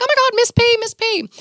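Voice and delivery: high squealing fan gush. Retold or imitated. Fans online gushing over her mom, squealing excitedly. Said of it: "you guys are like".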